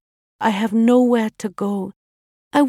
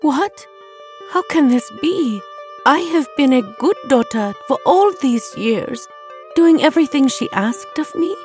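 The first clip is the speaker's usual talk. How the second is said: higher-pitched hurt daughter; tearful disbelief; 6 semitones higher than usual. A narrator's reading. Banished princess questions the guards, tearful. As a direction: plead in wounded bewilderment. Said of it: "tears filling her bright ebony eyes".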